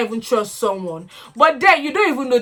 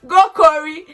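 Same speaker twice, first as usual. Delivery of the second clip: higher, excited chant; cheering Corey on from the couch; 7 semitones higher than usual. Real, not acted. Excited fan cheering Corey on from the sofa, higher chant.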